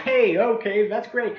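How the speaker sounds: high, amused "hey, okay"; amused homeowner. Retold or imitated. Neighbor at the door, amused and won over, high. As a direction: play the neighbor warming up to the pitch. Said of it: "They're going".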